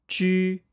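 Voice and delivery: ju said high with rounded lips, Mandarin ju; pinyin ju. Shown as a demonstration. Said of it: "if you round your lips while saying ji".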